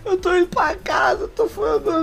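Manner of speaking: high, tearful retold call to dad; crying to dad. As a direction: sob it out like a frazzled kid. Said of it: "eu liguei pro meu pai chorando".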